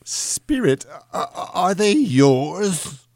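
high, halting, stammered question; appalled Scrooge. A narrator's reading. Scrooge asks the spirit about the children, appalled. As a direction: stammer it out high and slow, aghast.

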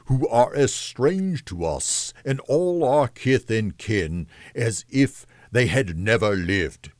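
slow, weighty ghostly pronouncement; lightly voiced spirit giving a grave rebuke; solemn reproving spirit. Ghost's speech in the story.

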